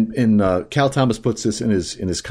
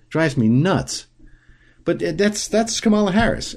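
ordinary delivery

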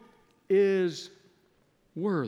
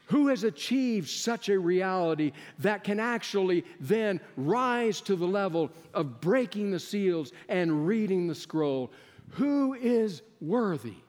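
raised, ringing pulpit voice; rising sermon cadence, higher pitch; preaching at full force; 5 semitones higher than usual. Acted. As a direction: call the question out to the congregation. Preacher builds rhetorical questions.

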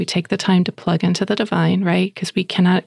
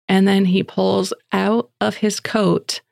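ordinary delivery

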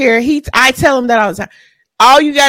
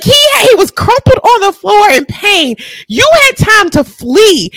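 heated disbelief; 9 semitones higher than usual. Real, not acted. Scolding the woman who didn't flee, heated. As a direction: keep hammering the point, pitch up. Continued outrage at staying.

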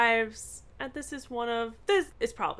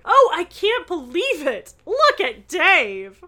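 louder, higher burst of delighted disbelief; gleeful outburst; 7 semitones higher, 8 dB louder than usual. A narrator's reading. Bess pointing out Dave in costume, gleeful. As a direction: read it like she can hardly contain her giggles. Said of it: "Bess burst into laughter".

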